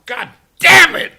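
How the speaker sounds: loud high frustrated yell; shouted curse. Real, not acted. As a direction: blow up on it. Outburst in rant.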